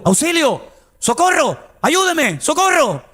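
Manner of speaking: high, urgent calls for help; cries for help. Retold or imitated. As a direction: call out like a drowning man. Drowning man crying for help. (gritos agudos de auxilio)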